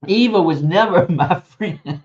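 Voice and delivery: real laughter breaking into the line; laughing. Real, not acted. Recalling strict mother, laughing.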